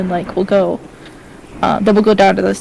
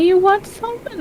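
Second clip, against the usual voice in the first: high cheery offer; 10 semitones higher, 3 dB quieter than usual. Acted.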